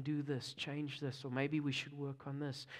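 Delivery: soft, gentle nudging voice; quiet voice of God. Retold or imitated. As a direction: hush it like an inner prompting. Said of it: "he's saying".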